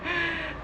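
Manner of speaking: soft high giggle; short shy laugh; quiet high giggle. Real, not acted. Laughing about sponsored outfit.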